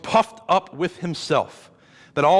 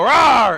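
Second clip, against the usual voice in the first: big mock roar for the kids, higher and louder; hammed-up playful growl; playful monster roar; 8 semitones higher, 9 dB louder than usual. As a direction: roar like a pretend monster chasing children. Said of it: "I will be the big bad monster ... I'll go".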